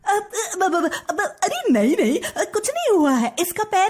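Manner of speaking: high, stammering panic; flustered cover-up. Acted. Stepmother caught by her husband beside the crying girl, flustered. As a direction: scramble for an innocent excuse.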